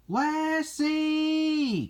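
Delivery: high, long-held name call. A narrator's reading. Cleo calls for her daughter, high drawn-out call. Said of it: "called the voice".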